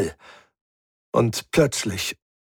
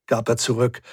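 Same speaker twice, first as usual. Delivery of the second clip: plain reading voice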